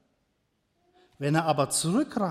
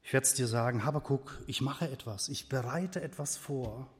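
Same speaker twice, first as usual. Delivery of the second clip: softer, lower divine answer; quiet voice of God; 4 semitones lower, 8 dB quieter than usual. Acted. God answering Habakuk, soft and low. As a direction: speak as God, calm and secret. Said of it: "Du willst wissen".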